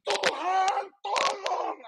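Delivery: high, drawn-out plea; crying out. Acted. Preacher showing believers how to call on Jesus, crying out. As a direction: call upward for help at a high pitch. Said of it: "untuk teriak kepada Yesus".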